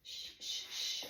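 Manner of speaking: repeated soft "shh"; soothing shush. Shown as a demonstration. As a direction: hush a crying baby while walking.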